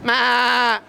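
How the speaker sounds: long, put-on lamb bleat. Lion cub answers his father, sheepish bleat. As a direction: answer only with a drawn-out bleat. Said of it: "lamb, sheep says".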